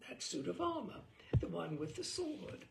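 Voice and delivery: ordinary delivery, nothing marked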